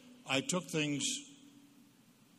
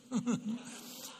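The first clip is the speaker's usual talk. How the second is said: big laugh; 9 semitones higher than usual. Real, not acted. After punchline.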